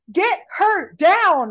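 drawn-out frustrated demand; exasperated yell. Acted. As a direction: call it out to the screen, word by word. Exasperated shout at film characters.

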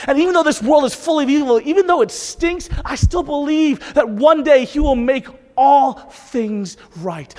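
high, loud declaration of faith; full-force preaching. Real, not acted. Preacher declaring belief despite an evil world, full-force preaching. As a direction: proclaim it with rising fervor.

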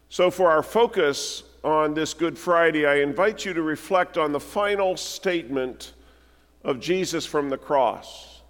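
ordinary talk, nothing marked

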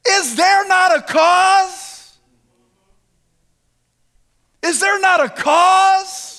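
high, drawn-out shout; shouted challenge. Acted. David challenging the frightened army, shouted challenge. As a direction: stretch the last word into a ringing cry.

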